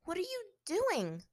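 high, slow, fearful question; edge of panic. A narrator's reading. Captive watching her captor's water orb, fearful. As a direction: let fear edge into the voice. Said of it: "panic and fear edging into her voice".

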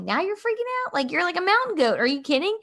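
high-pitched disbelief, retold; mock-astonished parent challenging a kid; incredulous. Mother's incredulous retort to her son.

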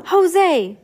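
high, loud little shriek. A narrator's reading. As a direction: shriek it out sharply. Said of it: "Sonny shrieked".

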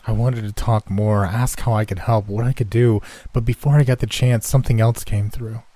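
ordinary delivery, nothing marked

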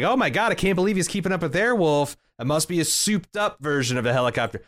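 high, fast, panicky Dom; high-pitched mock panic over a chase; mock Dom freaking out. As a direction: play the baffled sidekick, hammed up. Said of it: "he's like".

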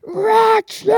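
high, loud sneeze shaped like "Rachel"; mock sneeze. Imitating her mum's sneeze, high and loud. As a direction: blast it like a huge sneeze. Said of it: "That's what my mum's sneeze sounds like".

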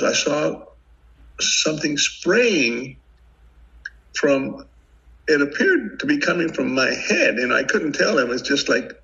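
ordinary delivery

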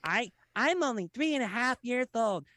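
high, put-on child voice; mock toddler pleading; little kid voice. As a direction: whine like a tiny kid. Kid viewer voiced in story.

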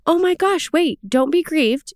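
high, urgent plea that slows at the end; eager reassurance. Retold or imitated. Nehemiah urging the weeping people to stop grieving, high and eager. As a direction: jump in high, then ease off to calm them. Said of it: "And he's saying, like".

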